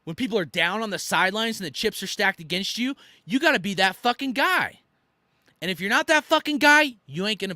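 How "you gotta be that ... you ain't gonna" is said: fired up. Real, not acted. Fired-up rant on leadership.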